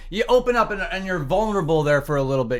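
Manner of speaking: ordinary talk, nothing marked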